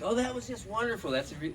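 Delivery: mock gushing compliment, high; high-pitched mock rave; gushing praise. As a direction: sing out fake praise in a high voice. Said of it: "He doesn't go like".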